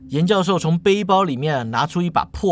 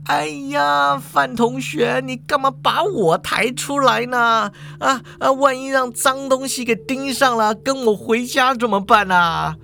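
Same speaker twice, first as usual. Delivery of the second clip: high, whining protest; flustered principal; 7 semitones higher than usual. Acted. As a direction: wail in alarm at being dragged into it.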